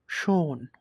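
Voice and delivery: "শোন" with a long drawn-out o, silent h; lengthened vowel. Shown as a demonstration. Teacher showing vowel before h, "শোন" with the o held long. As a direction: stretch the o in "শোন". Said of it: "ওটা একটু টানে পড়তে লাগে".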